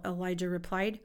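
usual speaking voice

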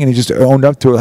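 ordinary talk

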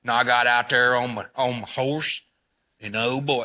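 put-on low, slow old-cowboy drawl. Imitating the old YouTube cowboy for the room, slow drawl. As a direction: stretch it out like an old cowboy on his horse. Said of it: "He talks like this with a real slow drawl".

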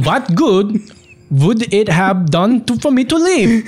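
high, hammed-up German accent that drifts Italian; overdone German accent, half laughing; stronger German accent. Shown as a demonstration. Cohost asks for a stronger German accent. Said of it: "You should do that in a stronger German accent".

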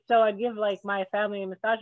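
usual speaking voice

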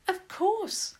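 high-pitched, smug lilt; lofty, pleased-with-herself reply; smirking. A narrator's reading. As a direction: answer with an audible smirk. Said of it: "Clara said with a smirk".

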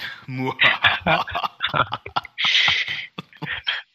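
breathless, airy burst of laughter; loud wheezing laugh. Real, not acted. Host laughing at his own prank idea, wheezing laugh. Big laugh after joke.

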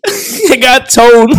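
slow laughing repeat. Real, not acted. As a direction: say it through laughter. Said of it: "laughing repeat".

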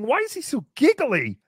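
ordinary talk, nothing marked

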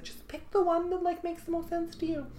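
put-on very high gushing girl voice. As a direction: coo it like a ditzy girl. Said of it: "She's like".

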